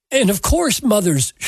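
usual speaking voice

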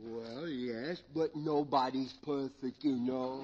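tipsy, sheepish admission; comic drunk. Acted. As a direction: play a drunk owning up reluctantly. Drunk character admits marriage.

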